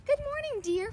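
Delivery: bright, high call of good morning; cheerful greeting. A narrator's reading. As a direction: greet her warmly and happily. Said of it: "Flora cried".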